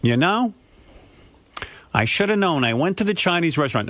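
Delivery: lightly voiced worried mother; imitating mother. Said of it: "my mother says to me".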